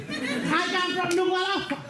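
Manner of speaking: ordinary delivery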